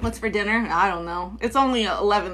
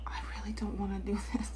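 whisper; 2 semitones lower, 14 dB quieter than usual. Real, not acted.

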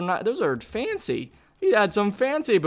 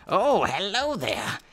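slow, hammed-up villain greeting; mock evil villain; 4 dB quieter, much slower than usual. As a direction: drawl it like a lightly voiced cartoon villain. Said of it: "What evil villain would I be?".

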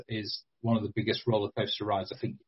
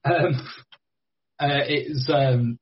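smiling voice; 5 semitones higher, 5 dB louder, much slower than usual. Real, not acted. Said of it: "I can hear the smile".

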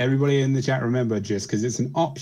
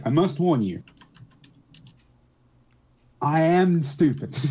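slow, dopey confession voice; dim-witted character; 5 semitones higher, slower than usual. Retold or imitated. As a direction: play the slow, dopey one. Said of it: "one of the members of the group is like".

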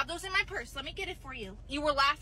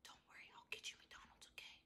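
conspiratorial whisper; 26 dB quieter than usual. Acted. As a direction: hush it like a secret promise. Dad secretly plotting McDonald's.

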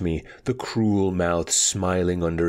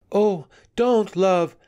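much higher, louder anxious plea; 14 semitones higher, 4 dB louder, slower than usual. A narrator's reading. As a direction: plead anxiously as the worried Anika, pitched right up. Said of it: "said Anika anxiously".

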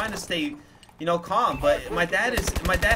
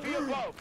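short, quiet gasp for air; a sharp little catch of breath; imitated gasp; 9 semitones higher, 8 dB quieter than usual. Storyteller imitating his younger self losing his breath, imitated gasp. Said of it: "you just go".

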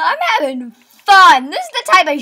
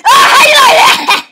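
high, loud gloating through laughter; laughing triumphant shout; 11 semitones higher, 7 dB louder than usual. Real, not acted. Gamer gloating at a victim, laughing loudly. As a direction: crow over him, laughing.

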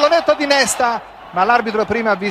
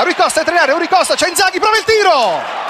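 excited play-by-play; 6 semitones higher, faster than usual. Real, not acted.

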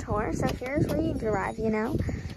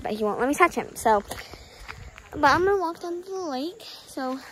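ordinary delivery

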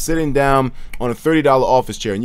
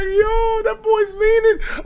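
pitched high and slowed, cracking up; drawn-out high amused cry; high slow laughing; much slower than usual. Retold or imitated. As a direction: stretch it out, half laughing. Reacting to the tweet, retold.

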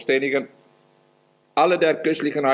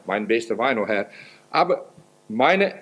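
normal talking voice

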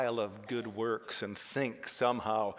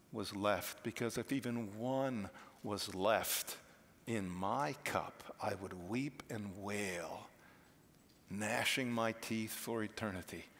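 hushed; 3 semitones lower, 6 dB quieter than usual. Real, not acted. Preacher drops low on personal fear.

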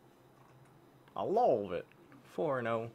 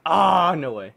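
frustrated shout; 12 dB louder, slower than usual. Real, not acted.

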